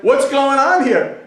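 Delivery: loud, high, stretched-out officer's challenge; indignant demand. Acted. Lieutenant comes upon the burial, loud slow indignant demand. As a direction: demand answers, drawing the words out.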